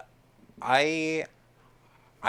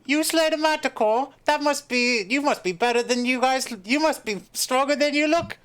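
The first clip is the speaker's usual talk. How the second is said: much higher-pitched put-on accented voice; shopkeeper character voice; 11 semitones higher, 3 dB louder than usual. Shopkeeper sizing up the manticore slayer, high character voice. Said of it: "she looks up at you and it's like".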